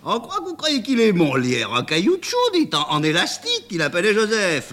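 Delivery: rire dans la voix, très aiguë; hilare. Acted. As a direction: se moquer gentiment de la naïveté. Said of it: "que cette idée met en joie". (le chauffeur s'amuse de l'idée d'Amanda en riant)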